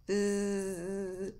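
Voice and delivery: long steady buzz; droning bee noise; imitated bee buzz. Shown as a demonstration.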